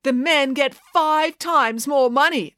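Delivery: slow, high, emphatic announcement; drawn-out rallying point; public speech. A narrator's reading. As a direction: spell out the injustice to a crowd. Maya addresses the crowd.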